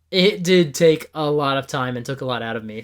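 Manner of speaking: usual speaking voice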